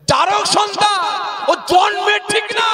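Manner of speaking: high shouted voice of God; shouted divine verdict. Acted. Preacher voicing God's verdict to the Prophet, shouted high. Allah's answer repeated in the story.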